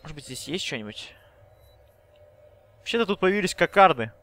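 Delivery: ordinary delivery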